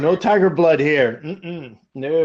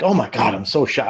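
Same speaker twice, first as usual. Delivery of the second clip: mock shock; 7 semitones lower than usual. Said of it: "they're shocked".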